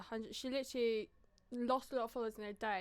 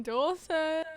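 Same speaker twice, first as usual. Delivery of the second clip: drawn-out name; 4 semitones higher, 11 dB louder than usual. Pretend. Drawn-out listing of influencer names.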